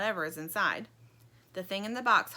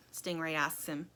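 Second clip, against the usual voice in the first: usual speaking voice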